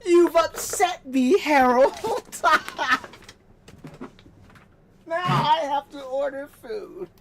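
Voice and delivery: loud, slow hammed-up grievance; mock upset. Ranting fan telling off the company, hammed-up hurt. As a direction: pretend to be wounded, loudly.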